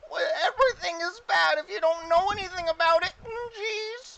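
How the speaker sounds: very high, loud goblin whine; goblin chorus. Acted. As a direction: squeal it like a fretting goblin. Goblins answering the player.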